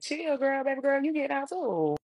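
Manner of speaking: put-on high-pitched "relax, girl" coaxing; playful reassurance. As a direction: talk a weepy friend down in a high voice. Telling crying friend to chill.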